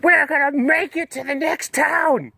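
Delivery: slow, very high put-on voice; hammed-up weary traveler, drawn out and high; suffering laptop voice. Beaten laptop fearing collapse before next town, high put-on voice. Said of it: "I was beating my laptop into oblivion".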